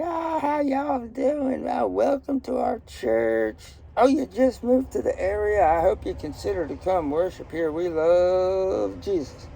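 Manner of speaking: gushing Southern greeter. Acted. Church greeter welcoming newcomers, gushing Southern accent. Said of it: "doing this with a Southern accent".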